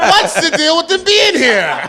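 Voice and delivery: high, loud hammed-up complaint; laughing mock tirade; mock stand-up rant. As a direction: belt it out as a parody comic. Joins in the mock racist routine.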